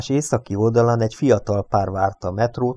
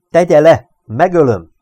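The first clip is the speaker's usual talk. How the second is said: very loud, high command; staccato threat; 7 semitones higher, 9 dB louder than usual. A narrator's reading. Reynolds demands the gun be put down, staccato shouting. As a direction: shout each order forcefully. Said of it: "Reynolds hangja hűvös volt és pattogó".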